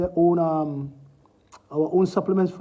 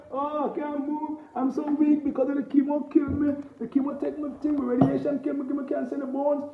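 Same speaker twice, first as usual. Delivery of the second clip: mock bawling patient; 7 semitones higher, 5 dB quieter than usual. Mocking sick patients who refused his advice, whiny lament. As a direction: imitate a weak chemo patient moaning. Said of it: "you're gonna cry, you're gonna bawl all day".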